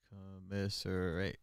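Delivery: "Commiserate" drawn out slowly and softly; sounding it out. Real, not acted. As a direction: stretch "Commiserate" syllable by syllable, under your breath. Sounding out word to spell it.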